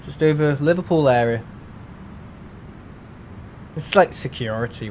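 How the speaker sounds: usual speaking voice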